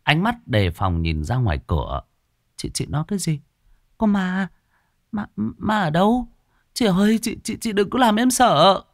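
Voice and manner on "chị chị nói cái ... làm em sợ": high-pitched, stammering fright; scared young employee begging not to be frightened; alarmed and bewildered. Acted. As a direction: question anxiously, tripping over the words. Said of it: "cũng hoang mang".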